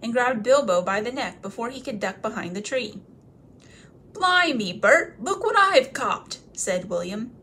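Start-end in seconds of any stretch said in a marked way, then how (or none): 4.1-6.4 much higher, loud troll crowing; excited troll. Acted. William showing Bert the catch, high and loud. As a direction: crow over the catch.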